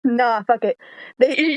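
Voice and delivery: ordinary delivery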